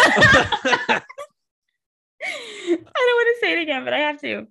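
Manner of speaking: high, loud giggling words; laughing. Real, not acted. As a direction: laugh your way through it. Laughing fit.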